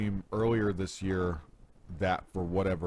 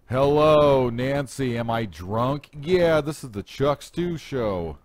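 loud, high-pitched mock show opening; mimicked radio host; 7 semitones higher, 9 dB louder, slower than usual. James mimicking his brother's radio show, hammed-up loud host voice. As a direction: belt out the greeting, drawing out the sign-off. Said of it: "James was mimicking me while I was on my radio show".

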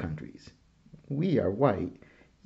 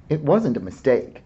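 firm objection; 4 semitones higher, 9 dB louder than usual. A narrator's reading. Sister Liz objects firmly.

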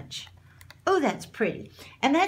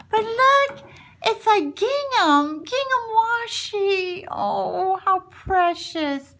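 thrilled; 9 semitones higher, slower than usual. Real, not acted. Thrilled by gingham tape.

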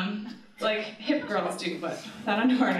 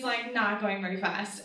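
usual speaking voice

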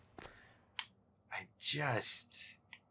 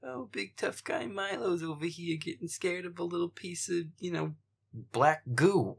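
high, sneering mock voice of the friend laughing; mocking imitation; 6 semitones higher, 6 dB louder than usual. Mocking the friend who laughs at his fear. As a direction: taunt like someone laughing at him.

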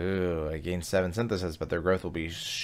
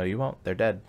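usual speaking voice

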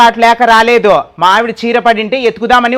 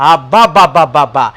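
low, groaning wail of dismay; lamenting; 7 semitones lower than usual. Acted. Husband wailing low to the neighbours over the saree. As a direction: moan over the lost saree.

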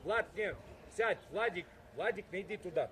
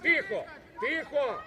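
shouted hushing; 3 semitones higher, 5 dB louder, slower than usual. Real, not acted. Coach quieting the players' parents, calling out. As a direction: call out over the noise to silence them.

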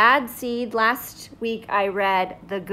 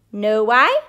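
plain reading voice

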